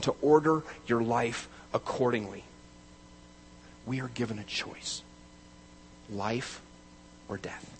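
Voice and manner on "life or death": usual speaking voice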